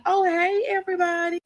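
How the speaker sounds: high, loud fake-sweet hello; ex-fiancée greeting the family, high and fake-sweet; sugary ex greeting. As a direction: chirp it in high like the ex. Ex arrives, voiced high.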